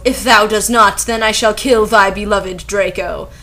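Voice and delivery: ordinary delivery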